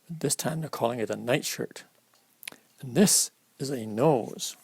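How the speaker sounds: ordinary delivery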